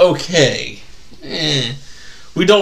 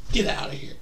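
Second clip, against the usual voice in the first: normal talking voice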